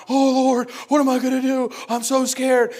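hammed-up panicked wail, high and loud; mock freaking out. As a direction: cry out like disciples tearing their hair out. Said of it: "they're freaking out".

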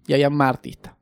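ordinary delivery

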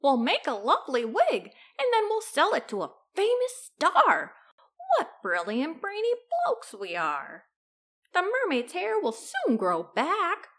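excited crook with big pitch swoops; gleeful villain. A narrator's reading. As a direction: crow over your brilliant plan. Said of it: "said Hefty Hugh to Lanky Len".

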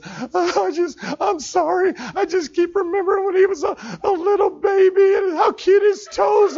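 put-on high, wavering old woman's voice; tearful grandma. Grandma leaving the hospital room, tearfully remembering. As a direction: sob out the memory. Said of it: "The last thing she says".

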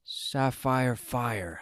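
slow, flat repeating of the words; slow disbelief. Acted. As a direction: drag it out in disbelief.

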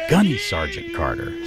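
high, slow, drawn-out sergeant call; drill sergeant. Retold or imitated. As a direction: sing it out like a parade-ground caller. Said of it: "I'm like".

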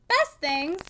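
loud, scornful outburst; severe exclamation. A narrator's reading. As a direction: throw the words back in scorn. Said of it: "exclaimed Missus Glegg with severity".

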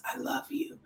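soft unvoiced whisper; whispered tenderness to her viewers; whispered love. Real, not acted. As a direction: breathe it to them like a secret.

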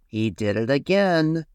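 lightly voiced shout from a fine brush; mild shout. A narrator's reading. Fine brush tells on the little paintbrush, mildly shouting. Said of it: "shouted the fine brush".

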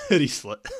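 laughing as it's said; words bursting out in laughter. Real, not acted. Repeating friend's joke, laughing.

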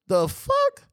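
can't believe it; indignant. Real, not acted.

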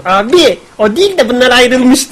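high, drawn-out mock announcement through laughter; slow, high imitation breaking into a laugh; Ali Cin popping up. Co-host mimicking someone popping up with breakup news, high laughing imitation. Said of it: "şey gibi, Ali Cin gibi çıktı".

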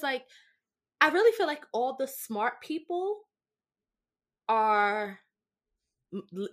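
usual speaking voice

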